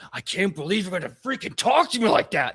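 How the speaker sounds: loud, high, indignant rant; acted angry outburst; outraged orc boss. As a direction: blow up at an underling. Orc boss rages at underling.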